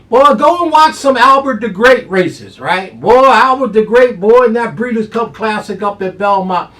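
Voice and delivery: high, loud hyped-up call; excited hype. Real, not acted. Handicapper pumping up his top pick, loud and high. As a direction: shout out the top pick like a showman.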